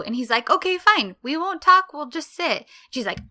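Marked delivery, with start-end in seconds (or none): 0.5-2.7 easygoing bargaining. Retold or imitated. Imitates him bargaining with her.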